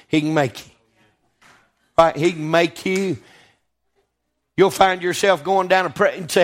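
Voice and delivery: ordinary talk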